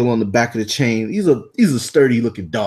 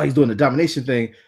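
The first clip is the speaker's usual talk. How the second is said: usual speaking voice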